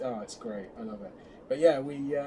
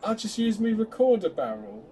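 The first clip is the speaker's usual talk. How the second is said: imitating the Doctor; 8 semitones higher, 8 dB louder than usual. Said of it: "he's gone".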